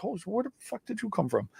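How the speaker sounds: high, soft startled whisper; startled hush. Retold or imitated. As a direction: gasp it quietly at a bird that appeared. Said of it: "I'm like".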